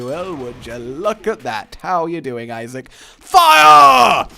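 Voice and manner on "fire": drawn-out, high battle yell; shouted command. Pretend. Player ordering an attack on Isaac, shouting. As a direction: bellow the order at full tilt.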